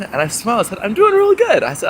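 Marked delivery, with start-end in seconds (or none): 0.8-1.6 much higher and louder, beaming; cheerful chirp. Retold or imitated. Answering a passerby's how are you, high and beaming. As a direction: answer with a big bright chirp.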